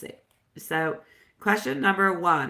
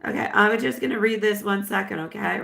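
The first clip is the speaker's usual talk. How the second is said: ordinary talk, nothing marked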